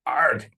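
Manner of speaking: short loud angry yell; frustrated shout. Acted. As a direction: let out a sharp frustrated shout. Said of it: "[name] shouted".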